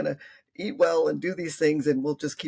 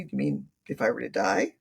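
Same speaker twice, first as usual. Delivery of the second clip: gentle grandmother; 6 semitones lower than usual. Retold or imitated. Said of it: "And I said".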